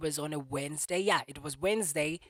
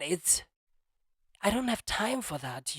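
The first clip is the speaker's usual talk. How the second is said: normal talking voice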